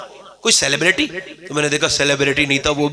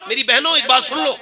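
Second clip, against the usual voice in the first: usual speaking voice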